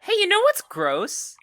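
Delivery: squeaky high question. Real, not acted. Sudden playful outburst.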